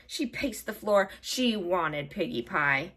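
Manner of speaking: ordinary delivery, nothing marked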